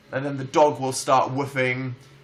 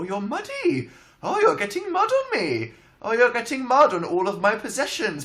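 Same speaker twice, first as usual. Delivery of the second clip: put-on doting doggy baby talk. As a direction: coo at the dog like a baby. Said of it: "I was just baby talking to the dog".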